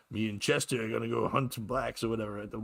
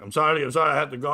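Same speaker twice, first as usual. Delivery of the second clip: louder, higher, hurried-sounding excuse; agitated apology; 4 semitones higher, 7 dB louder, faster than usual. Retold or imitated. Driver excusing himself to stranded passenger, agitated. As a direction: imitate a flustered driver pleading.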